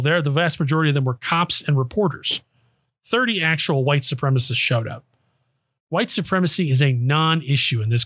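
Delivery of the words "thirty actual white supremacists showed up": usual speaking voice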